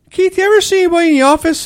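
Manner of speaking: high, quick mock boss voice; manager question. Worker imitating his manager asking about the office, high and fast. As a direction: rattle it off like a clueless manager.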